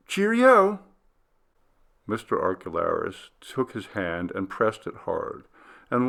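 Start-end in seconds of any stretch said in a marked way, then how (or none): 0.1-0.8 high, loud farewell call; cheery call. A narrator's reading. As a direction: call it out as he leaves. Harry's parting call.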